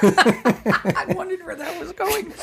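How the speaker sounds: laughing. Real, not acted. Laughing reaction.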